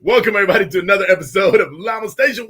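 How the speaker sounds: loud, high show opener; pumped-up broadcast welcome; host intro. Acted. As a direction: belt out the welcome like a radio host. Show host opening, big intro.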